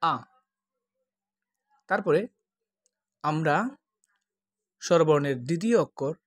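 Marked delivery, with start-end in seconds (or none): 0.0-0.1 short open আ; Hindi a. Shown as a demonstration. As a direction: say আ, Hindi style. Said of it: "তারা বলে".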